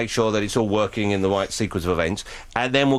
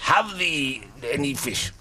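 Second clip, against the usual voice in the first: put-on Scottish accent; 4 dB quieter, much slower than usual. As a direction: ham up an old-fashioned Scottish accent. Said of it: "I can do the Scottish accent quite well".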